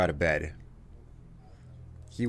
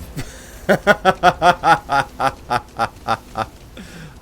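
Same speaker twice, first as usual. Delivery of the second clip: long, rhythmic run of laughter; 4 semitones higher, 4 dB louder than usual. Real, not acted. Streamer laughing at viewers' generous gifts, long laugh. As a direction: laugh on and on in steady bursts. Chat keeps throwing money.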